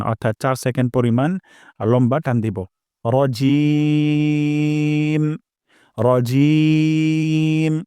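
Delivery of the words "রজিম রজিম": "রজিম" very slow, i held four seconds, higher; three alif stretch. Shown as a demonstration. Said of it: "জিম এখানে আমরা তিন আলিফ পরিমাণ". ("রজিম" ই তিন আলিফ টেনে)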